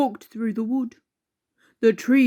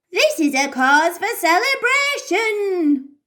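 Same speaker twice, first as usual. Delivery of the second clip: grandma voice; 7 semitones higher than usual. A narrator's reading. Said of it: "said Grandma".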